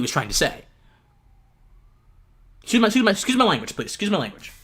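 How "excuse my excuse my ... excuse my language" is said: rushed apology. Real, not acted.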